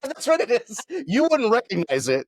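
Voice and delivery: high-pitched mock politeness; put-on polite voice. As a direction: lift it high, teasing the kids. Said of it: "That's my polite voice".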